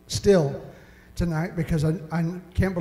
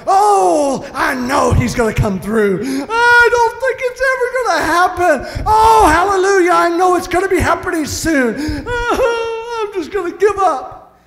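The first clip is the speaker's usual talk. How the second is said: high hammed-up wailing and crying; mock self-pity; 10 semitones higher, 4 dB louder than usual. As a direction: swing between hope and despair like a melodramatic sufferer. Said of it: "we're like".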